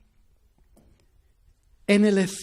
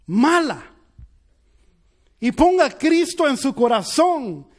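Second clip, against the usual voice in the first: loud, raised preacher's voice; impassioned sermon climax; fervent preaching; 3 semitones higher, 3 dB louder than usual. Acted. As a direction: preach it to the congregation with fire. Preaching at full force.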